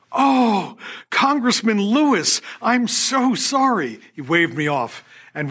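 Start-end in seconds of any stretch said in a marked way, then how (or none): 0.1-4.0 high, slow, flustered apology; higher-pitched, slow embarrassed Oh. Retold or imitated. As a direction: catch yourself and apologize, mortified. Apologizing after naming him wrong.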